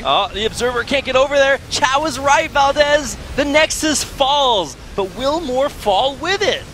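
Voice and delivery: excited high play-by-play; rising hype over a big fight; esports caster. Real, not acted.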